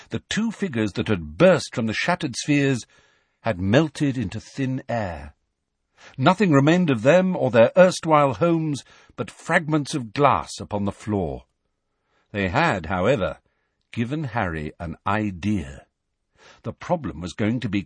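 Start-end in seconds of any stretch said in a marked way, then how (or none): none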